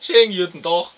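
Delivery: blustering boor ranting about his wife; crude husband. Acted. Plays the crude husband Herr M.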